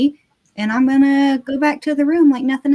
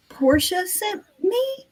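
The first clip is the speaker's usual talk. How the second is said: nervous bluff; 6 semitones higher, 3 dB quieter, much slower than usual. Acted. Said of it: "Give me a deception".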